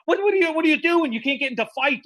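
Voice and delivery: high, fast, alarmed scolding; a boss hissing panic at an employee; retold yell. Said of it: "He's, he's yelling".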